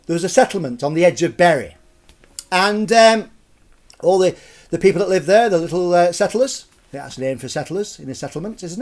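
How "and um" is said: ordinary delivery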